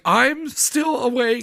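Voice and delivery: slow, high-pitched put-on voice; sleepless friend impression. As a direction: drag it out as the wide-awake friend.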